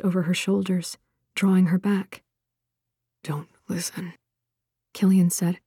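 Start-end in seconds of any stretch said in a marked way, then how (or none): 3.2-4.1 very soft breathy whisper close to her ear; softer intimate whisper of warning. Acted. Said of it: "his breath warm against her ear".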